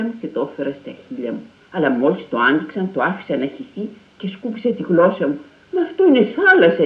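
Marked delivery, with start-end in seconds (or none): 5.7-6.8 high, loud startled exclamation; surprised cry. A narrator's reading. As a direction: blurt it out in sudden surprise. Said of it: "είπα ξαφνιασμένος".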